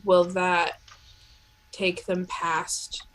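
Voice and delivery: ordinary delivery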